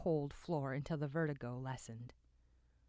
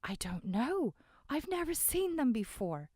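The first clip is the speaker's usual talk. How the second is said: put-on shaken young man; 9 semitones higher, 5 dB louder than usual. Young witness answers, shaken.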